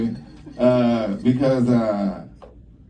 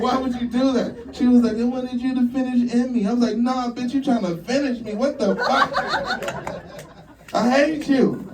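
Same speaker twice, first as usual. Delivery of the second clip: high squeaky argument; high voiced bedroom quarrel; high mock quarrel; 10 semitones higher than usual. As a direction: play both sides in a high voice. Quarrel with girlfriend retold.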